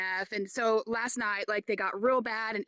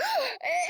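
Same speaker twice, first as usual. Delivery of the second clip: sharp, much higher startled gasp; retold gasp; 11 semitones higher than usual. Reliving the hummingbird scare, a high startled gasp. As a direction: gasp like a bug just dive-bombed you. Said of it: "And I was like".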